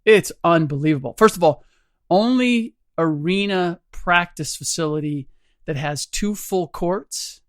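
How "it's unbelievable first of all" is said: ordinary talk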